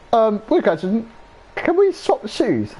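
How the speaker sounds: very high, slow, hesitant ask; squeaky sheepish request. Retold or imitated. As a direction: ask it timidly and drawn out. Retold awkward request.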